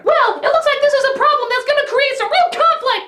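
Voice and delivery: high-pitched put-on girl voice; earnest daughter. Daughter argues with her Penguin father, in high mock voice.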